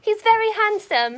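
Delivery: slow, high mock-British; drawn-out amateur British accent; attempted British accent. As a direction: gush slowly in fake British. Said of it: "do it in a British accent".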